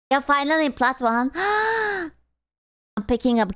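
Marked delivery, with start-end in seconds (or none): 1.4-2.1 high, quick intake of breath; a startled little gasp; sharp gasp. Real, not acted. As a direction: gasp in delight. Excited gasp at rank news.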